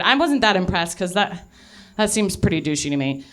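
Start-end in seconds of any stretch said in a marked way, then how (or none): none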